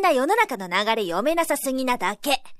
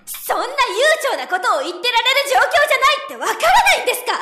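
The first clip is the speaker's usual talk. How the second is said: exasperated retort; 10 semitones higher, 5 dB louder, faster than usual. Acted. Arguing with her superior.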